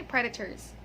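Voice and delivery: "predators" as PRED-uh-ters, short first E; correct pronunciation. Shown as a demonstration. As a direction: stress the start of "predators", weak middle. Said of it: "it's predator".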